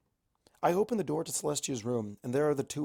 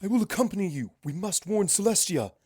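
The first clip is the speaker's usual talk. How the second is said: higher, firm royal voice; a princess declaring her duty; higher, resolute Luna voice; determined princess Luna; 7 semitones higher, 5 dB louder than usual. Acted. Luna insists on coming along.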